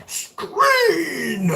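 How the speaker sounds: screen yelled high as a question; mock scream of screen. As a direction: shout screen with a rising question. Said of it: "Are you screaming it like".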